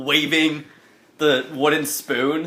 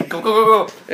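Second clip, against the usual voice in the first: loud, higher urgent hurrying; urgent, raised-voice chivvying; rushing someone along; 7 semitones higher, 4 dB louder than usual. Acted. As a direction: hustle them along with quick repeats. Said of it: "she's, like, rushing you along".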